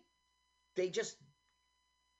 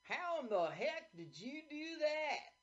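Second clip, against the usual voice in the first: put-on old prospector; 11 semitones higher than usual. Voicing the movie's old character.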